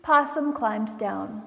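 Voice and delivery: ordinary delivery, nothing marked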